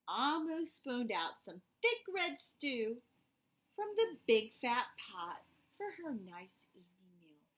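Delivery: put-on gentle read-aloud for kids; storybook narration. A narrator's reading. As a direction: tell it like a bedtime story. Reading picture book to children.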